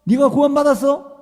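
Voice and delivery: high, loud scolding shout; thundering rebuke. Retold or imitated. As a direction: thunder at the boy. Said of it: "목사님 막 강하게 막".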